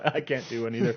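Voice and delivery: normal talking voice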